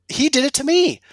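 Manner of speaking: high, loud amazed burst; excited. Retold or imitated. Golfer recalling the fitter's new shaft, excited. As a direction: blurt it out amazed.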